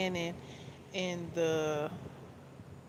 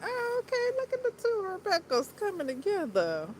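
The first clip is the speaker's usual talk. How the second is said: high-pitched delighted gush; excited; 12 semitones higher than usual. Retold or imitated. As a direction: squeal with joy at the coincidence. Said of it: "I was like".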